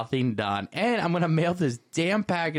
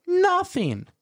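emphatic; 4 dB louder than usual. Real, not acted. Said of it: "emphatic answer".

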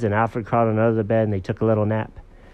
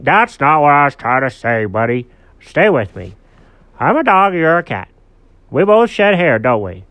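louder, higher storybook dog; emphatic, slightly impatient dog buddy; earnest dog character; 4 semitones higher, 7 dB louder than usual. Acted. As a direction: insist like a cartoon dog making his point.

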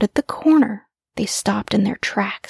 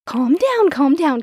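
lightly voiced mother, raised pitch; a touch of a mother settling excited kids; 6 semitones higher than usual. A narrator's reading. Mother to her two cubs, lightly calming them. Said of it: "Mother said".

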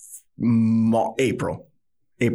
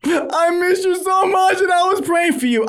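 very high, loud mock wife; gushing outburst; 5 dB louder than usual. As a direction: gush it in a squealing falsetto. Wife's emotional reply, acted.

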